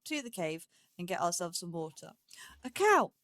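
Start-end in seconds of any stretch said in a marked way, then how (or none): 2.6-3.1 higher and louder, delighted to spot it; surprised exclamation. Real, not acted. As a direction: blurt it out in happy surprise. Spots a cow suddenly.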